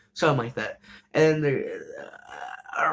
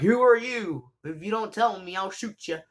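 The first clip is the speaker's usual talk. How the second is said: mock grandma threat; 6 semitones higher than usual.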